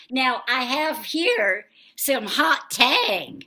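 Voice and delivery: ordinary talk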